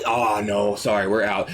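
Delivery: low, insincere clerk's apology; feigned regret to a customer; fake apology. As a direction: drop low and pretend to be sorry. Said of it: "you're just lying".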